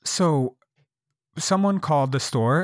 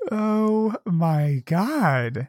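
very slow, higher, drawn-out dismay; appalled; 6 semitones higher, much slower than usual. Real, not acted. Hearing Jesus was acting as a pimp, appalled. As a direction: stretch every word in disbelief.

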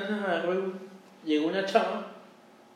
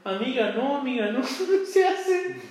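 mock horrified friend; 6 semitones higher than usual. Friend's horrified reaction retold.

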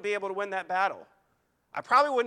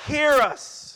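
frantic crying out; 6 semitones higher, 9 dB louder, much slower than usual. Retold or imitated. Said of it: "prophets of Baal say".

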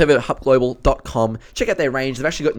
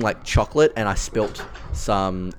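ordinary talk, nothing marked

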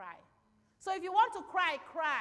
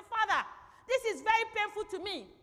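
pleading prayer; 5 semitones higher, 4 dB louder than usual. Pretend. Voices a believer praying to God.

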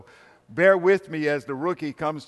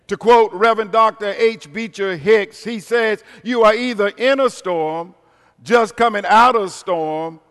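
preaching at full force; 6 semitones higher, 9 dB louder than usual. Acted. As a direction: preach it out to the congregation.

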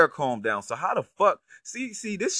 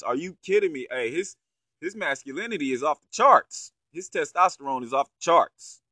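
usual speaking voice

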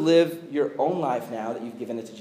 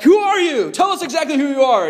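demanding shout; 8 semitones higher, 7 dB louder than usual. Retold or imitated. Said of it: "So they're like".

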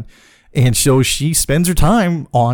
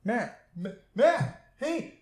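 friend calling out; 6 semitones higher, 5 dB quieter, slower than usual. Retold or imitated. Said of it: "I could hear fucking Dylan going".

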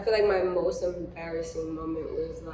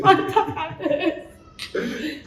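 laughing grimace; 9 semitones higher, 11 dB louder than usual. Real, not acted. Reacting right after the shot.

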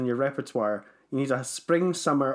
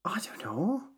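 whisper; 6 semitones higher, 6 dB quieter than usual. Real, not acted. Pondering best purchase.

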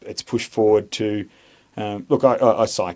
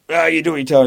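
high, loud barked order; mock instructor yell; 9 semitones higher, 10 dB louder, faster than usual. Instructor yelling at a recruit on day two, loud mock bark.

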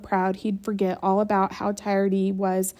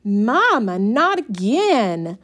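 slow drawn-out whine, louder; whining; 2 semitones higher, 5 dB louder, slower than usual. A narrator's reading. Brenda whining to Mama, slow and drawn out. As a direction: whine it long. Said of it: "Brenda whined".

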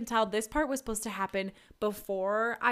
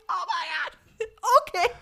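high laughing yelp into oh my God; laughing panic; 9 semitones higher, 6 dB louder, faster than usual. Retold or imitated. Patient as the needle goes in, laughing yelp. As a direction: laugh through the shock.

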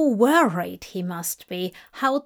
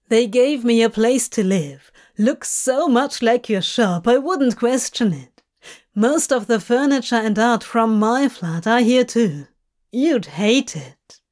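Crowley, teasing; a demon describing his new home; Crowley, amused; 4 dB louder than usual. Acted. Crowley voiced in podfic.

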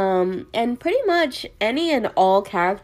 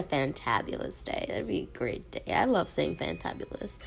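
whispering; 2 semitones lower, 8 dB quieter than usual. Real, not acted. Playful aside about fun words.

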